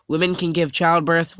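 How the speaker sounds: ordinary talk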